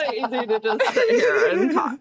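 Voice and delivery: put-on high, bubbly Valley Girl voice, laughing; ditzy Valley Girl, way up high. Shown as a demonstration. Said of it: "Valley Girl voice".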